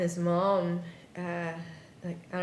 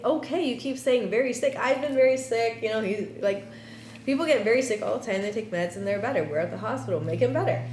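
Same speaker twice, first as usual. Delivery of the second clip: retold impatient rebuttal, higher and quick; dismissive protest; 4 semitones higher, 3 dB louder, faster than usual. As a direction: argue back in a raised, hurried pitch. Protesting inwardly at nurse's warning.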